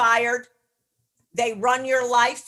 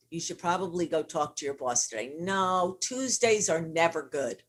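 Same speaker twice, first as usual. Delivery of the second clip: lower, softer, talking yourself out of it; a lightly voiced timid inner voice, hushed and lower; chicken voice; 5 semitones lower, 3 dB quieter than usual. Coach voicing the listener's avoidant chicken voice, softer and lower. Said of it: "The chicken voice might say to you".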